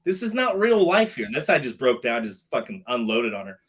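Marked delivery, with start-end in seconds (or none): 0.0-1.2 high, loud pushback; heated protest. Retold or imitated. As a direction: argue hard with the instructor. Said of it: "I go".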